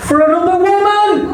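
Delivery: high, loud outburst of disbelief; mock shock. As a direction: blurt it out in fake horror.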